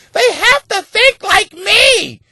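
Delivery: high, slow mocking woman's wail. Mimicking women who want men to think like them, high mock wail. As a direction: stretch it out as a whiny caricature.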